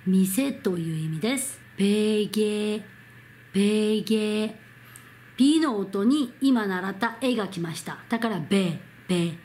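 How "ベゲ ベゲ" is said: ベゲ slowly, both syllables held level; 発音のお手本. Shown as a demonstration. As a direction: model ベゲ as "beh-geh", drawn out. Teacher models a Korean word. (ベゲをゆっくり平らに伸ばす手本)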